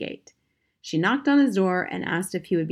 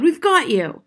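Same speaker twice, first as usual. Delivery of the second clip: high, loud triumphant shout; bold, high-pitched yell; 9 semitones higher, 8 dB louder than usual. A narrator's reading. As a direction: yell at the cornered intruder. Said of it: "she yelled".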